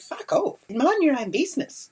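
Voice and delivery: high indignant brush-off; retold angry dismissal. Telling the filming woman to leave, indignant dismissal.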